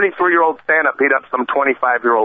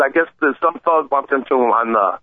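ordinary talk

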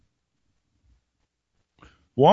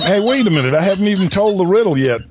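mock protest; 7 semitones higher, much faster than usual. Mock protest at peanut gallery.

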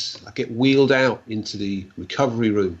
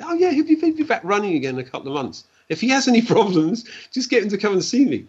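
upbeat reassurance; 9 semitones higher, faster than usual. Retold or imitated. Reassuring the wife cheerfully.

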